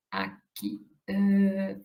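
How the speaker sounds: ordinary delivery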